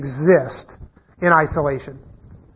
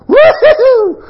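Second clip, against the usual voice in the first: much higher, much louder laughing woo-hoo, slow; gleeful whoop; 9 dB louder, slower than usual. Retold or imitated. Son sliding off the roof, imitated laughing whoop. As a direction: whoop like falling is a thrill. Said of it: "all he can think to do is go".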